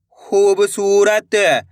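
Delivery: syllables joined into one slow word; blended word. Shown as a demonstration. Teacher joining the syllables for learners, slowly blended. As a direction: read it as one whole, stretched word. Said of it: "इनको आपस में लाकर पढ़ना है".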